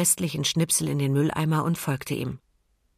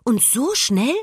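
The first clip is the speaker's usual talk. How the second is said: amazed; 7 semitones higher, 4 dB louder, slower than usual. A narrator's reading. Emma still amazed.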